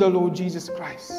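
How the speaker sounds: ordinary delivery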